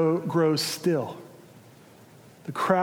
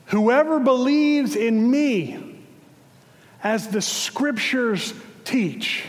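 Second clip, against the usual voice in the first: loud proclamation; 5 semitones higher than usual. Acted. Jesus interrupting the holiest moment of the feast, loud proclamation. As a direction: proclaim it to the whole temple court. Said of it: "said in a loud voice".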